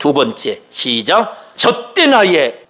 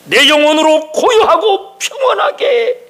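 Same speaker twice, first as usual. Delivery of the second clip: higher, ringing declaration with 고요하고 lifted high; ringing proclamation; 8 semitones higher than usual. Shown as a demonstration. Preacher proclaiming the verse back to the congregation, high and ringing.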